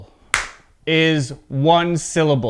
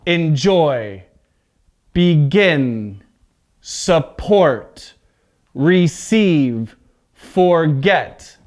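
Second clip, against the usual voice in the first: last syllable stressed in Enjoy, begin, support, receive, forget; word list, each verb hit on its end; second-syllable stress; 2 semitones lower, much slower than usual. Shown as a demonstration. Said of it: "at the end of the word".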